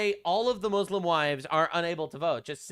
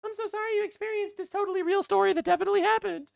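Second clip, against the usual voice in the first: much higher, syrupy put-on voice; mock gushing sympathy. Mocking the communists' sympathy, high gushing voice. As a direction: gush fake concern in a high silly voice. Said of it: "All the communists".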